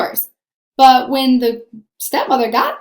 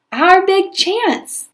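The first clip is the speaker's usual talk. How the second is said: slow, much higher delight; excited; 8 semitones higher, much slower than usual. A narrator's reading. Stepmother thrilled at the slipper news, slow delight. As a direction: savour the big chance gleefully. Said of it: "stepmother's excitement".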